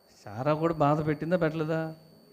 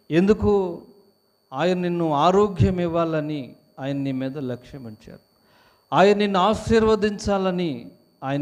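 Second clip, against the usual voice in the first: fervent preaching; 3 semitones higher, 6 dB louder, slower than usual. Acted. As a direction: preach it out with full force. Preaching at full force. (సంఘానికి ఉత్సాహంగా గట్టిగా ప్రసంగించడం)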